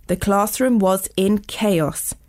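plain reading voice

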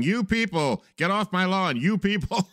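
mock get-off-my-lawn yell; grumpy old man. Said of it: "balled up fist on the front yard yelling".

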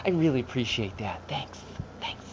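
soft half-smiling whisper; leaning in to whisper. Retold or imitated. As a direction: lean in and whisper politely. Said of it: "You got kinda".

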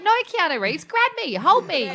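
high, hammed-up plea to be held; mock pleading. Acts out her own drunken plea.

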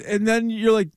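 normal talking voice

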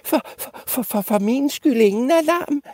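tiny high stammering squeak; meek little goat. Acted. Littlest goat wanting no fuss, meek high stammer. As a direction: stammer it very high, timid and small. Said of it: "mindste bukke Bruse spagt".

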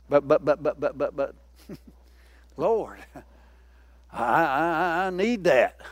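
low, soft, hesitant stammering; sheepish pleading under the breath; reluctant mumble. Acted. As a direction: mumble like someone who doesn't want to give. Reluctant giver pleading with God.

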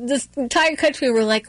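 usual speaking voice

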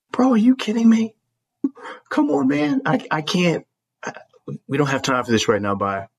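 exasperated plea. Retold or imitated. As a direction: beg him in disbelief.

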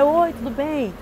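high, sing-out hello in a retold scene; acted high-pitched greeting; chirpy greeting. Acted greeting on returning at night.

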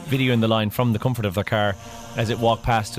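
usual speaking voice

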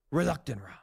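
normal talking voice

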